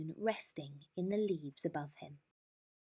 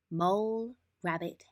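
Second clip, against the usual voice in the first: usual speaking voice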